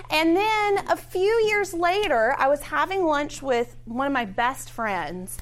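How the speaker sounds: usual speaking voice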